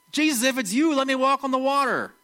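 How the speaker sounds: high, eager call across the water; eager Peter. Retold or imitated. Peter calls to Jesus on the water, eager and high. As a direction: Peter begging to step out of the boat.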